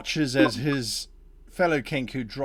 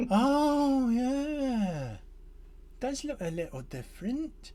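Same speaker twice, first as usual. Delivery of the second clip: high, lightly voiced goblin, fading softer; musing slowly, a bit dim; 11 semitones higher, 3 dB quieter than usual. Gextrol noticing the tree looks different, lightly voiced. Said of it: "He's like".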